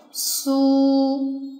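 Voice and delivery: su held long and steady; long closed-lip vowel. Shown as a demonstration. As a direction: draw out the u in su. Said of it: "长音阶合口".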